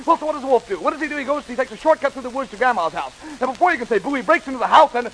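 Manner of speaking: breakneck comic storytelling; as fast as possible. Shown as a demonstration. Storyteller hurrying the wolf's part of the tale, rapid-fire. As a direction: rattle the tale off at top speed. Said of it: "just as fast as I can tell it".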